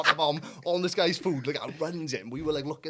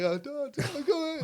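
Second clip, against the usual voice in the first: high panicked call; 10 semitones higher, slower than usual. Retold or imitated. Kids calling dad.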